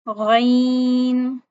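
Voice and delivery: wrong demo, गैन pulled too wide; over-spread ghain. Shown as a demonstration. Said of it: "इसको ज्यादा फैला देना".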